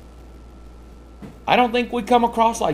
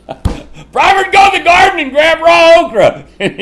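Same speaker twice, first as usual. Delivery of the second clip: high, loud, bursting with laughter; laughing shout; 13 semitones higher, 7 dB louder than usual. Real, not acted. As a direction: tell it while cracking up. Laughing memory of friend.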